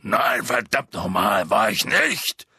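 loud, drawn-out indignant denial. A narrator's reading. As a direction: deny it hotly in a big put-on voice. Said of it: "sagte Hagrid entrüstet". (laut und entrüstet)

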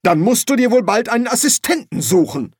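high-pitched, snappish retort; angry snarl. Acted. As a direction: spit it back, high and cross. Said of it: "fauchte Silberfisch".

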